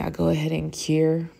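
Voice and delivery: ordinary talk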